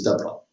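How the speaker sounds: de Broglie said as "duh BROY"; de Broglie ending in an "oy" sound; correct pronunciation. Shown as a demonstration. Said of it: "pronouncing like this way".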